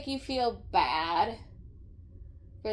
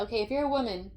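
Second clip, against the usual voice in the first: ordinary talk, nothing marked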